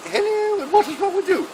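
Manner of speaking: high-pitched mock-royal greeting; Princess Anne. Princess greets the sergeant in the next bed, high mock-royal voice. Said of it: "She goes".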